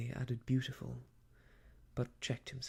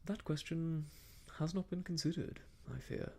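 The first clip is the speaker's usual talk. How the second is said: higher, mildly uneasy reply; growing uneasiness; 5 semitones higher than usual. A narrator's reading. Young man cornered about his plans, uneasy. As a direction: admit it with understated unease. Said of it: "with growing uneasiness".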